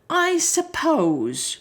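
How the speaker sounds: slow, high thoughtful voice; musing lion. Acted. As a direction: draw it out, pondering. Lion muses.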